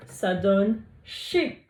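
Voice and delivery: ça donne chez stretched slowly, chez rising; slow blending. Shown as a demonstration. (lecture lente de chez)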